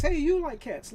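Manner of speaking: high and loud, stress on you; mock eager hello again; excited greeting. Same greeting repeated.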